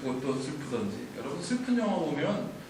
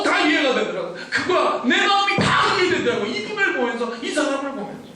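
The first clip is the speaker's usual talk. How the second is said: high, loud, emotional preaching near shouting; impassioned preaching; 11 semitones higher, 5 dB louder than usual. Acted. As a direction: pour your heart into it. Preaching on the cross.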